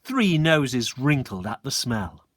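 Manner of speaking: plain reading voice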